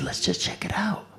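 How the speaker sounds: whisper. Retold or imitated. Urging friend on dark road.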